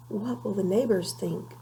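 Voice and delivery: ordinary delivery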